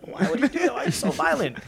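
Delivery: high-pitched mock whine; naive protest. Young viewer upset by Omar's violence, high naive protest. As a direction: plead in a high, childish mock voice.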